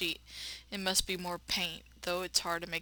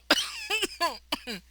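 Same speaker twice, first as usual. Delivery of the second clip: a long, hacking, wet cough; hard cough; 12 semitones higher, 4 dB louder than usual. Acted. Dying narrator hacks loudly in the dark hall, harsh cough. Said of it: "I can't handle hearing someone, like, cough real hard like that".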